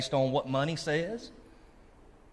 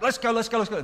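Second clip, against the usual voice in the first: higher-pitched, mock-impatient "let's go"; impatient urging; 6 semitones higher, faster than usual. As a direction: hurry the donkey along, hammed-up. Balaam urging his stubborn donkey.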